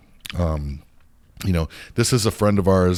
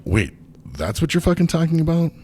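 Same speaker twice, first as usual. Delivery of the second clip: stunned disbelief; 10 semitones higher than usual. Retold or imitated. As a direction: pitch jumps up in shock. Retelling his disbelief on call.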